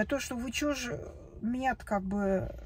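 ordinary delivery

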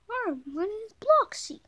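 high, loud cartoon kid voice; bright squeaky kid character introducing itself; Bloxy's voice. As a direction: pitch it way up and chirp the name. Child voicing Bloxy character.

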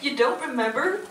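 ordinary talk

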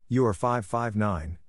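ordinary delivery

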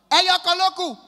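high, loud vendor's call; imitated food seller calling out; street hawker's shout. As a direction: holler your wares out loud. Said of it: "come dey shout".

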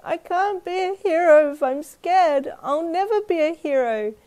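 high, loud, slow wail; bawling. A narrator's reading. Boy despairing of being a hero, bawling. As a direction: wail it out like a heartbroken little boy. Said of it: "He started bawling again".

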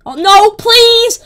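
loud, high, slow cry of protest; drawn-out alarmed shout; panicked plea. Real, not acted. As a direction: beg loudly to be spared. Player under attack, begging.

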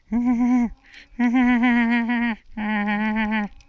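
loud high-pitched laughing fit; high squealing laugh. Real, not acted. Laughing at dog Sean.

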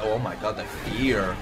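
ordinary talk

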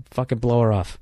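usual speaking voice

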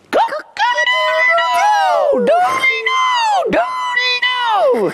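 very high, drawn-out crow; rooster crowing. Acted. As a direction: crow at full throat like a rooster. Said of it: "the rooster throws back his head to crow".

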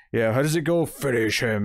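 mock-awkward joke delivery; awkward quoting. Podcaster imitating Barry's clumsy Mortal Kombat joke, awkwardly. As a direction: fumble for the catchphrase, then draw it out. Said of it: "he kinda awkwardly goes".

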